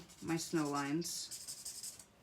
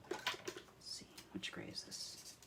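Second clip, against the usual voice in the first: soft unvoiced whisper to self; hushed private aside; whispered to herself; 14 dB quieter than usual. Real, not acted. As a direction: whisper it like thinking aloud. Muttering to herself while choosing.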